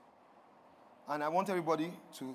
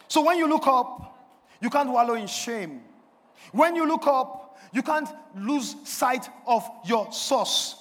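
forceful preaching; 5 semitones higher, 6 dB louder than usual. Real, not acted. Preacher encouraging the congregation to look up, forceful preaching. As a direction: hammer each promise home deliberately.

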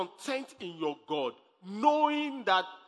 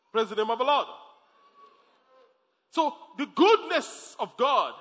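ordinary talk

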